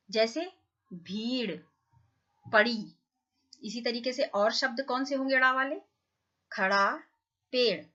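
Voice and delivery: ordinary talk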